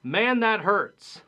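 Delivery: a slow, loud, hammed-up ouch; hammed-up exclamation of pain; mock pain. Shown as a demonstration. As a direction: act out a sore hurt, drawn out.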